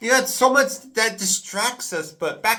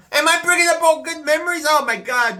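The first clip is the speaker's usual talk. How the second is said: excited; 9 semitones higher, 4 dB louder, faster than usual. Real, not acted. Excited aside to viewers.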